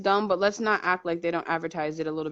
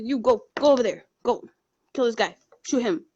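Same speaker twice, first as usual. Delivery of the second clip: raised-pitch, short commands; mock military orders; 4 semitones higher, 4 dB louder than usual. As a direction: order a soldier about, lightly acted. Voices military giving orders.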